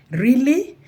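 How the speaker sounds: high rising disbelief; incredulous. Retold or imitated. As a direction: ask it in sheer disbelief. Incredulous reaction to colleagues.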